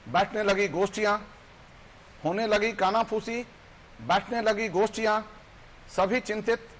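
loud, high, ringing verse recitation; declaimed poem. Acted. Guest recites his poem's rising public uproar to viewers, loud declamation. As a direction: declaim it forcefully, with pauses between phrases.

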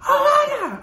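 high, loud imitation of the little girl screaming; mock child scream. Imitating the screaming niece, loud and high. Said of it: "she's screaming".

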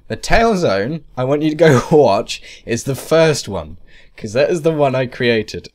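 ordinary delivery

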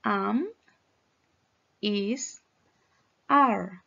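each word said alone, carefully; slow teacher's pronunciation drill; repeat-after-me model. Shown as a demonstration. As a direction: model "Am, is, are" with gaps between. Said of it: "Repeat after me".

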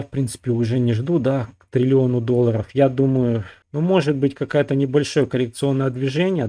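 ordinary delivery

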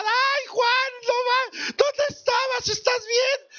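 very high, tearful mock panic; a mother sobbing in relief, hammed up; frantic mother. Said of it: "súper preocupada".

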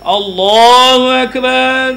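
slow, loud chanted Allahu Akbar like an imam; drawn-out takbir. Shown as a demonstration. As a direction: stretch Allah Akbar into a long ringing call. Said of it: "ایک عام آدمی ... وہ کیا کرتا ہے".

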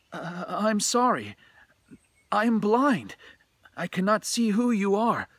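apologize, unsettled by the unseen caller; flustered Hoichi. A narrator's reading. Blind Hoichi answers unseen voice.

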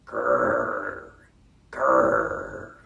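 low rolled growl; dog growl. Shown as a demonstration. Reader leading children in growling like this dog. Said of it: "Let's try growling".

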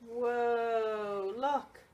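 ordinary delivery, nothing marked

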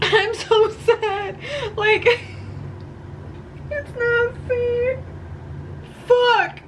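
high, tearful voice breaking into sobs; real tears, half laughing through them; choked up and crying over a book. Real, not acted. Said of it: "it's probably making me cry right now".

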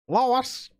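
high call of a name, put-on voice; Peter Griffin impression. Streamer imitating Peter Griffin, calling out. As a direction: call out for Lois in cartoon-dad voice.

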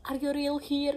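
mock a friend's startled yell; retold yell. Friend shocked to see her, imitated yell. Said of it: "dia teriak kayak".

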